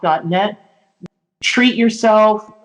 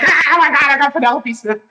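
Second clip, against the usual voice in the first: excited; 7 semitones higher, faster than usual. Retold or imitated. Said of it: "see, I'm like".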